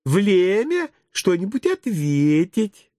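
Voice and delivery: high, slow, lisping coo; smiling teacher correcting. A narrator's reading. Teacher gently correcting her pupils, slow high cooing. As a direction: coo it slowly with babyish R's. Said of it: "с улыбкой поправила воспитательница".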